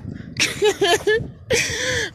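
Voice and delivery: real high-pitched giggle; high cackle. Real, not acted. Laughing about the churches, gleeful cackle. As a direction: cackle at your own remark.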